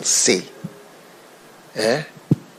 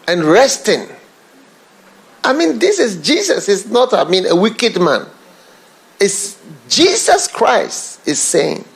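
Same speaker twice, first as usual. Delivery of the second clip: high, raised fervent preaching with a laugh; preaching at full force; 9 semitones higher than usual. Real, not acted. Preacher rousing the congregation, fervent and raised. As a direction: press the point hard from the pulpit.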